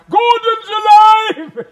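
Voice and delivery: bellowing his famous line for the Prime Minister; booming roar. Acted. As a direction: roar it slowly from on top of the table. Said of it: "Please say it".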